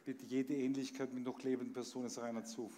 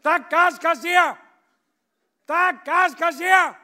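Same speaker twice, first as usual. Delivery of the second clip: huge roaring shout, much higher and louder; bellowing like a bull; 24 dB louder, slower than usual. Acted. Gas collector roaring at the parrot like a bull, full bellow. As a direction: roar it like a bull. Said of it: "brüllt so laut, als wäre ein Stier".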